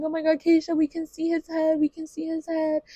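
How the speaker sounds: high-pitched thrilled exclaiming; excited mother. Retold or imitated. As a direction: imitate a mom urging on her daughter. Acts out her excited mother.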